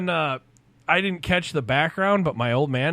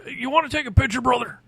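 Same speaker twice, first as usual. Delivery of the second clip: high, put-on wrestler voice; Hulk Hogan impression; 9 semitones higher, faster than usual. Wrestler offering a young fan a photo, Hulk Hogan impression. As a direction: play the big wrestler making an offer. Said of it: "looks at me and goes".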